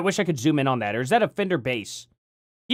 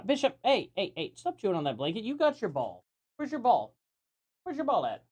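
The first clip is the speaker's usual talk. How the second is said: soft, higher coaxing voice for the dog; talking to the dog; 3 semitones higher, 9 dB quieter than usual. Real, not acted. Owner to dog chewing a blanket, soft coaxing. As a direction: gently scold the puppy, then coax.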